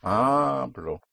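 Hablo with a long, stressed "Ha"; HA-blo, ending dropping away; stress on first syllable. Shown as a demonstration. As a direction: push down on the start of Hablo. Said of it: "push down on the first syllable".

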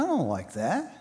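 low, soft sulky I don't like that; soft disapproval. Retold or imitated. Mocking the picky listener, quietly rejecting. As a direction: drop it low and sour. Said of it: "and they said, Okay".

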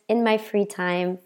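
normal talking voice

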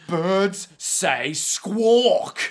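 hammed-up, drawn-out correction; indignant squawk. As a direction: stretch SQUAWK out long like a bird cry. [name] scolding bird, imitating squawk.

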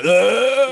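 high, loud mock "ugh" of a cutting-out connection; a quick high mock groan for the internet; imitated glitch groan. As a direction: groan like a dropped call. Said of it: "the internet went".

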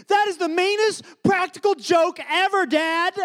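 high-pitched mock wail of accusation; upset daughter. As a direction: imitate a distraught child scolding a parent. Upset daughter accuses her dad.

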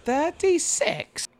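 high, drawn-out character voice; Dudley. Acted. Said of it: "Wasn't that Dudley?".

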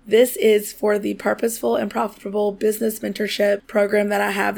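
ordinary delivery

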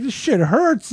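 high-pitched mock complaint; comic hurt indignation; retold pained protest. Retold protest at painful punches.

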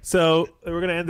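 yelling into the mic, clipping; clipping distorted mic. Real, not acted. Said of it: "stop yelling into the mic".

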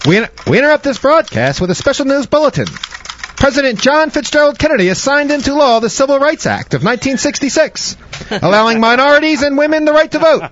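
put-on high, loud old-time newsreel announcer; grave 1940s war broadcast. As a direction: announce it like a wartime radio bulletin. Mock news bulletin voice.

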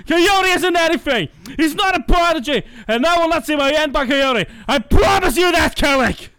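high, heated rant that peaks in a shout; a jealous villain swearing vengeance; bitter villain rant. Acted. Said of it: "I can put more feel into this than you can".

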